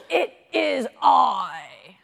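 booming, drawn-out billy goat shout; loud as the troll. A narrator's reading. Biggest goat answering the troll, very loud and slow. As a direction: shout it slowly at full volume. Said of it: "whose voice was as loud as the troll's".

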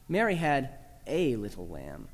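"a" set apart and said as long "ay"; pause, then stress "a" as "ay". Shown as a demonstration. Teacher showing a singular lamb, stress on "a". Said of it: "Emphasize the third word".

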